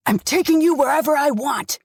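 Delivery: loud, harsh yell; bawled back. Acted. Father yelling back at daughter, loud. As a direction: throw it back at her hard. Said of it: "he bawled back".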